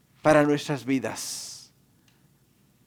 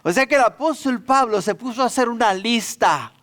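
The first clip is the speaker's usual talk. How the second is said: normal talking voice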